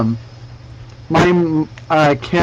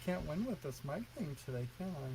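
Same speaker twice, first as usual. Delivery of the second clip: distant, off-mic voice; talking far from the microphone; 26 dB quieter, faster than usual. Real, not acted. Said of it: "you sound like you've gone to another room".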